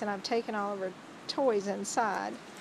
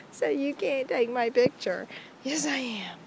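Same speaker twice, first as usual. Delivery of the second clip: ordinary talk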